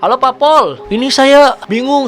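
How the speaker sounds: high, loud call to the police; confused emergency caller. Retold or imitated. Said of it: "dia bilang gini". (penelepon bingung, suara tinggi dan keras)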